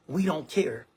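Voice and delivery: ordinary delivery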